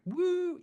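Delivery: high squeaky exhausted woo; a tired high-pitched sigh of exhaustion; worn-out woo. Retold or imitated. Pastor mimicking his own exhausted state, high woo. Tired after praying for everyone.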